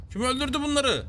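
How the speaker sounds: indignant high-pitched cry; mock outrage. Demands who killed the animals.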